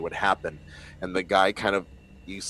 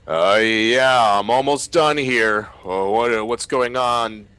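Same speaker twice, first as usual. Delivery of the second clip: louder, higher crewman answering over the radio, distracted; a busy crewman mid-task, calling back; [name] on the radio; 4 semitones higher, 9 dB louder, slower than usual. [name] answers the captain over radio, busy and distracted.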